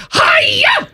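very high shrieking Hi-ya; Miss Piggy karate cry. Shown as a demonstration. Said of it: "Miss Piggy's up behind him going".

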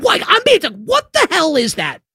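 high, sputtering bafflement; exasperated disbelief. Real, not acted. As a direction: voice jumping up in indignation. Exasperated at the coach's lie.

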